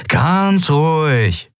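slow right down to placate them; placating. A narrator's reading. Captive leader to armed guards, placating. Captive calms his captors.

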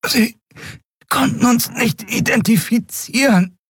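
high, strangled voice; choked. A narrator's reading. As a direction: squeeze the words out of a gripped throat. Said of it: "brachte sie erstickt hervor".

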